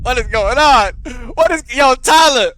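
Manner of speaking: loud, high-pitched disbelief; exasperated outburst. Real, not acted. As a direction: call him out, loud and incredulous. Exasperated outburst at Tyler over texts.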